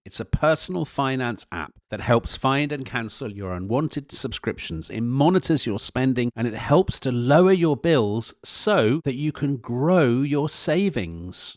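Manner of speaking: upbeat sponsor read. Acted. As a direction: sell it like a radio ad.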